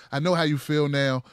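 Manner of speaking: normal talking voice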